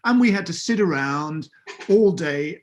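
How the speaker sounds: ordinary talk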